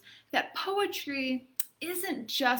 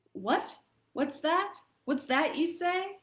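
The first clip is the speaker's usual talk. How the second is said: rising, higher curious question; surprised; 8 semitones higher than usual. Acted. Sick girl hearing news, surprised and higher. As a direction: perk up at the news. Girl hears it's Saturday.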